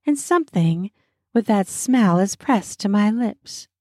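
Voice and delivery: plain reading voice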